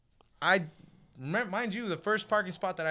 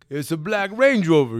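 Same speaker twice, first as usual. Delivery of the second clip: loud reply; 11 dB louder than usual. Retold or imitated. Shouted answer about the car.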